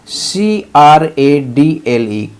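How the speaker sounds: letters spelled out one by one, slow; careful slow spelling. Shown as a demonstration. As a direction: spell it slowly for the class. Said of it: "spelling out letters".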